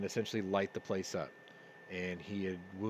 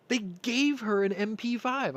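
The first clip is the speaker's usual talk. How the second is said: repeated much higher and louder in disbelief; amazed; 12 semitones higher, 6 dB louder than usual. Real, not acted. Repeating the gift news to listeners, amazed and louder. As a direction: say it again, thrilled and incredulous.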